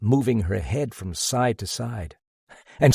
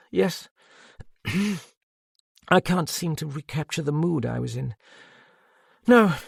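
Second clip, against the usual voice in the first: higher, faraway, drained woman recalling; a lightly voiced woman lost in memory; dazed Madame; 5 semitones higher than usual. A narrator's reading. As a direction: Madame tells the detective about Edouard, dazed and drained. Madame's voice held.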